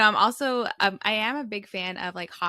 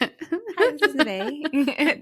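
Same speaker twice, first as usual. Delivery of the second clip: high, slow customer service voice; sweet receptionist greeting; phone voice; 6 semitones higher, 6 dB louder, much slower than usual. Shown as a demonstration. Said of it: "it's like I'm answering the phone".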